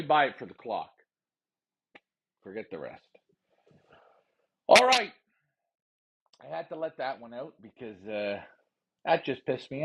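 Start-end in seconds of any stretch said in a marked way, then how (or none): 4.7-5.1 loud outburst. Real, not acted. Bursts out after rant.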